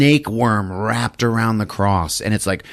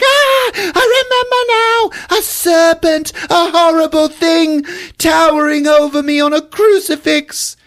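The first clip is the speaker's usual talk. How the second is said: melodramatic recall; 6 dB louder than usual. Retold or imitated. Eve remembering the serpent, hammed-up much higher melodrama. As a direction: gasp it out like a swooning heroine. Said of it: "and she's like".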